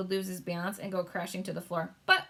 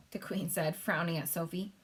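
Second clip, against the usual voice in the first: plain reading voice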